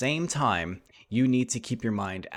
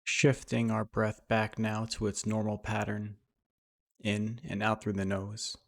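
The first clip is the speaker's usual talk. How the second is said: guided meditation; 5 dB quieter than usual. Acted. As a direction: lead them gently through breath and imagery, unhurried.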